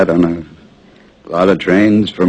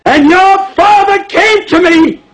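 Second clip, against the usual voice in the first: slow, high, hammering shout; outraged, drawn-out bellow; yelling; 4 dB louder than usual. Acted. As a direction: yell each word as a desperate reminder. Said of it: "I was yelling at him".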